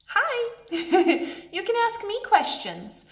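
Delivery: bright high hi breaking into a laugh; laughing greeting. Real, not acted. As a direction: pop in and laugh as you say it. Pastor pops up laughing.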